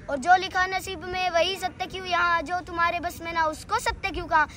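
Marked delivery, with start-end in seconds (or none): 0.1-2.4 raised, unbroken declamation; full-voiced recital. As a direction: drive through the verse in full voice. Full-voiced repeat of verse. (पूरे ज़ोर से कविता पाठ)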